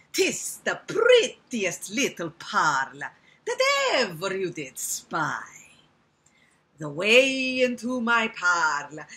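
loud, drawn-out Italian spider; Italian accent. Acted. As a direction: schmooze slowly in a big Italian accent. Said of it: "I can make him Italian".

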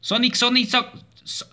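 high, rapid-fire alarm; loud yelp breaking into stammering; panicked stammer. Real, not acted. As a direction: stutter the name in a panic. Panics as Sonic appears.

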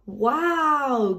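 high amazed gasp; a child gasping in wonder; little pig amazed at a tractor; gasped wow. Acted. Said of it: "gasped Pepper".

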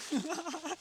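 short high laugh; quick high giggle. Real, not acted. Laughing at the situation.

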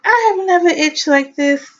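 high, exasperated complaint; exasperated quote. Retold or imitated. Pregnant vlogger retelling her itching, high exasperated squeak. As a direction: squeak it out in mock outrage. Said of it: "I'm like".

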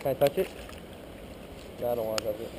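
ordinary delivery

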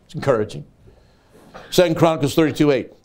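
ordinary talk

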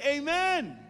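loud, high amen; shouted amen. Shown as a demonstration. Pastor leading the room in a shouted amen. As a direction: call it out big. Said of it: "Come on, somebody say".

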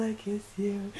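usual speaking voice

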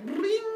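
high ringing string noise; imitated strum. Kid playing with the turtle shell, imitated twang. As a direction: trill it like a plucked string.

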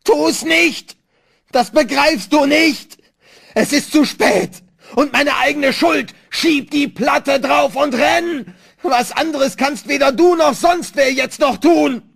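very high, anguished screaming; frantic, drawn-out yelling; scream of despair. A narrator's reading. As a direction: scream it slowly, utterly desperate. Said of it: "Schrei äußerster Verzweiflung".

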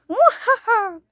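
evil villain cackle; hammy evil laugh; mustache twirling villain. Acted. Villain laugh after traitor talk. (risa malvada de villano)

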